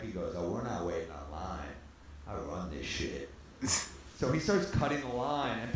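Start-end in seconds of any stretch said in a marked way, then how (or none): 0.4-3.3 low, soft, self-important dismissal; imitated big-shot cool; quiet mock swagger. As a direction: drop it down low like a local kingpin. Acting Stu refusing to queue.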